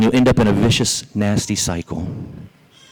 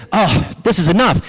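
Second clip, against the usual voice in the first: pitched-up storybook exasperation; mildly hammed-up, fed-up outburst; fed-up rabbit; 7 semitones higher than usual. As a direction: act out a rabbit who has had enough. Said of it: "He's getting furious".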